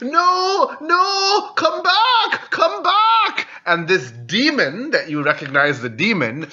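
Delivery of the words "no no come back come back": very high, squealing cry; panicked boy. Acted. Redhead boy chasing an escaped imp, high panicked wail. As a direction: call desperately after it in a tiny high voice.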